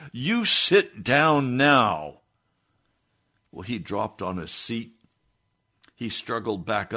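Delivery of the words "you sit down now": slow, much louder command, higher; ordered. Retold or imitated. Preacher pointing at the man, ordering him down, slow and loud. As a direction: spell out each word as a final order. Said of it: "I ordered".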